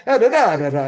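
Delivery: high, loud mock Italian babble; jolly Italian chatter. Mimicking the policeman's cheerful talk, high and loud.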